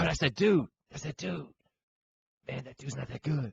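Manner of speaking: a mock hoops guy voice; lightly voiced pickup-game bystander. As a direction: play the trash-talking pickup guy. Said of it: "so you change the accent".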